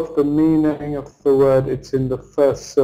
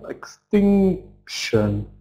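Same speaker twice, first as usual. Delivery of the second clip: "Extinction" very slow, long TINC, then a separate shun; stress before suffix; 5 semitones higher, much slower than usual. Shown as a demonstration. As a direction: break "Extinction" after a held, stressed TINC. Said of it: "We always have stress before".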